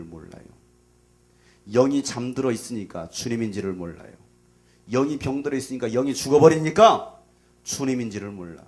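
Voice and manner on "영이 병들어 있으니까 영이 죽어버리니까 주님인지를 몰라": builds to a much louder, higher preaching cry; fiery preacher building to a peak; full-force preaching. Acted. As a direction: pound it home from the pulpit, climbing each line. Rising preaching climax.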